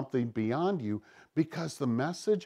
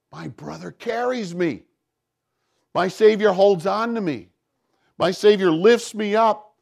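loud, rising pulpit fervor; fervent preaching; 6 semitones higher, 3 dB louder than usual. Real, not acted. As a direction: drive it home to the congregation. Impassioned climax of sermon.

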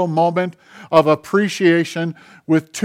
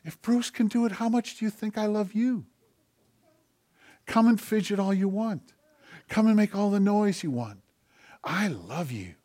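hushed voice of God; 4 semitones higher, 8 dB quieter than usual. Retold or imitated. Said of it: "God whispers".

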